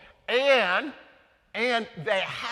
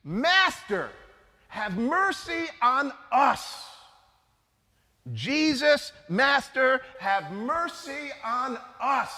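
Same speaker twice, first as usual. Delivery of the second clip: raised, drawn-out plea for mercy; pleading cry; 6 semitones higher, slower than usual. Retold or imitated. Lepers calling out to Jesus from afar, pleading cry. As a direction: call out to him from a distance, begging. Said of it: "They yell out".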